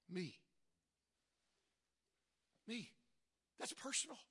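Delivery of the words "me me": ordinary talk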